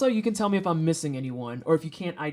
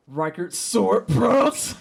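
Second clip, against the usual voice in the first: laughing; 3 semitones higher, 4 dB louder, much slower than usual. Real, not acted. Reading a funny name.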